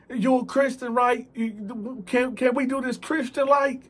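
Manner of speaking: a caught thief begging for mercy; pleading thief. Retold or imitated. Thief begging Dante to forgive him, whiny and high.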